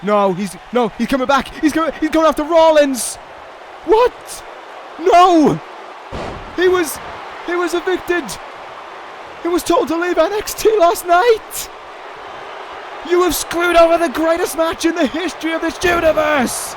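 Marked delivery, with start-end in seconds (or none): 3.8-5.6 high shocked What, then a loud No; real shock; shocked yelp. Real, not acted. Shock at the returning wrestler. 6.6-16.7 raised angry rant. Real, not acted. Raging at the interfering wrestler.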